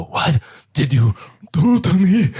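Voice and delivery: high, loud, panicked outcry; freaking out. Acted. Devil contorting as he turns on Vane, high panicked cry. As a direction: cry out as if losing control. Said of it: "As he begins to contort and freak out".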